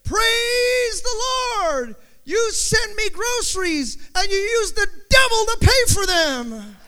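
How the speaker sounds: high, loud and slow, then rushing on; triumphant cry. Acted. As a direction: crow in triumph at the neighbour. Old lady's triumphant final answer.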